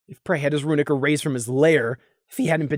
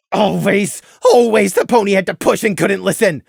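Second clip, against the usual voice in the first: plain reading voice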